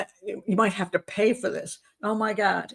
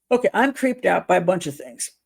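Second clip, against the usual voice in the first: ordinary delivery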